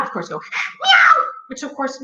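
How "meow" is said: very high, loud meow; piercing imitated meow; cat yowl. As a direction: screech like a startled cat. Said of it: "which made the cat".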